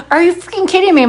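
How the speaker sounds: high, disbelieving outburst; can't believe what she hears; incredulous. Retold or imitated. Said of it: "I'm like".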